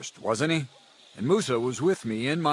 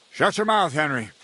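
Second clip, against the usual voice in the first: sharp rebuke; 5 dB louder than usual. Acted. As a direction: cut a subordinate off angrily. Superior silences Henry.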